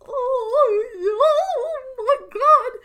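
high, loud wailing "oh my god"; emotional wail. Real, not acted. Fan wailing over favourite characters dying, high and loud. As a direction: wail like your heart just broke.

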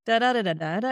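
Northern Irish melody sounded out in da syllables, starting loud; tune on da-da. Shown as a demonstration. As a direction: beat out the lilt on "da" alone. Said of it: "And you can hear".

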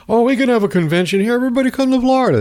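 high, fast, chirpy salesman voice; a mock-cheerful governor rattling off the invitation; mocking DeSantis. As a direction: sell Florida in a breathless high pitch. Mocks DeSantis inviting everyone to Florida.

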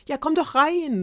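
high, loud eager call; excited welcome. A narrator's reading. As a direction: throw the door open eagerly. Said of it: "rief der König ihm begeistert zu". (begeistert und laut hereinrufen)

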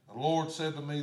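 ordinary delivery

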